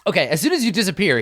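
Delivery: ordinary delivery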